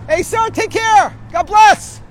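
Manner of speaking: high, loud, drawn-out farewell call; calling goodbye. Real, not acted. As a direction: shout a goodbye after someone walking off. Calls goodbye to departing stranger.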